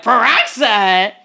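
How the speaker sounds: slow, disbelieving "Peroxide?"; incredulous peroxide. Retold or imitated. Kid balking at the peroxide gargle, slow and disbelieving. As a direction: stretch it out, appalled. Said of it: "I'm like".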